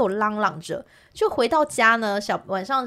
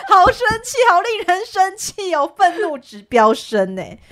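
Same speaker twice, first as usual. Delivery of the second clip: laughing anger; 8 semitones higher, 6 dB louder, slower than usual. Real, not acted.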